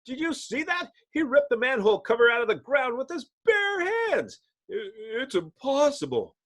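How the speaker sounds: high, fast awestruck voice; stunned witness. Acted. Witness after the manhole cover is ripped out, high and stunned. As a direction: gasp in disbelief.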